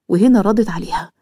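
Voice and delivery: usual speaking voice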